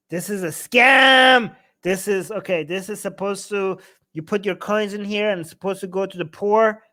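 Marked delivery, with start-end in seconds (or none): 0.1-1.6 loud slow outraged call-out; loud slow indignant cry of scam; outraged shout. Real, not acted. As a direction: shout it out like you just caught a scam. Outraged at a donation box.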